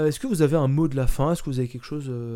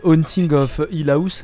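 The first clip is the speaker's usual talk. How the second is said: slow, louder put-on English accent on the title; 3 semitones higher, 7 dB louder, slower than usual. Podcast host pronouncing the series title in a put-on English accent. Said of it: "je le fais avec mon accent anglais".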